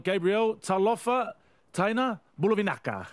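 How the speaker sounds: usual speaking voice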